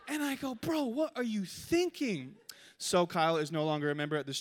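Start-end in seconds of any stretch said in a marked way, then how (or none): 0.5-2.4 high, slow, drawn-out disbelief; acted incredulous reproach. Shot player to apologetic teammate, incredulous reproach. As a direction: stretch the question in exasperation.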